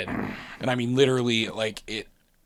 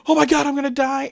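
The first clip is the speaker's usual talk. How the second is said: high, loud wail of doom; mock panicked cry; 13 semitones higher, 5 dB louder, faster than usual. Rider flying up out of the seat, mock panic. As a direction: imitate crying out in terror. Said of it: "I start screaming".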